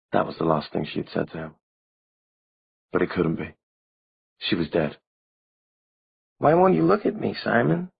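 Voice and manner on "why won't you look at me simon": put-on high and airy girl's plea; a ghostly girl asking sweetly; small girlish voice. A narrator's reading. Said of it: "Her voice was as small as ever, barely a breath".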